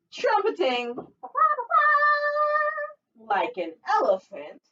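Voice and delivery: put-on sing-song read-aloud for little kids; storytime reading. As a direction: read it like a lively picture book. Teacher reads picture book aloud.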